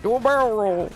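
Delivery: high shouted voice line, held flat; high-pitched game voice. Acted. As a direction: squeak out the lines like a game character. Mock game voice lines.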